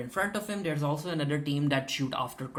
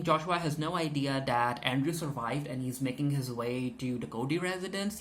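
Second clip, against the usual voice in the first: normal talking voice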